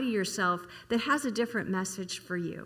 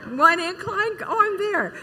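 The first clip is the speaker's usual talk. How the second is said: mock confidence; 7 semitones higher, 4 dB louder than usual. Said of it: "I thought, I thought".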